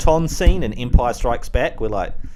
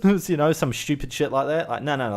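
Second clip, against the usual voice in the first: ordinary delivery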